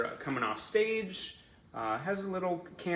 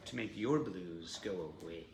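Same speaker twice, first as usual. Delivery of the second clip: low, creepy voice; 6 semitones lower, 5 dB quieter than usual. Real, not acted. Host croons over a blue poster, voice dropping creepily low. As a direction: voice sinking low and softer. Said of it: "let my voice go down that low".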